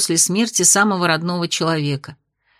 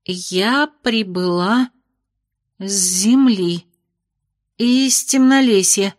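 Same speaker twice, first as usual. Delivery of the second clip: very slow, testing each word; slower than usual. Acted. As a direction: say it slowly, as if tasting the words. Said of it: "медленно произнесла слова".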